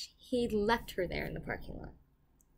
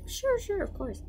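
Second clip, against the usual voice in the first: eager agreement; 12 semitones higher than usual. Retold or imitated. Replaying her yes to a worried neighbor, eagerly agreeing. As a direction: start way up high, then settle.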